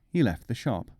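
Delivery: ordinary delivery, nothing marked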